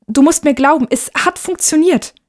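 put-on high, insistent boy's plea; urgent pleading. Boy begs mother to believe him, urgent.

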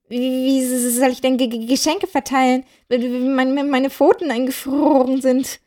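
frozen rabbit complaining to Santa; stuttering from cold. Acted. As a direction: shiver the words out in broken bits.

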